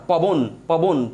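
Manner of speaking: পবন said slowly, stopping dead on the final ন; slow syllable demo. Shown as a demonstration. Teacher showing students পবন stops on a consonant. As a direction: clip পবন short at the end. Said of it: "পবন এ নয় এসে কিন্তু থেমে যাচ্ছেন".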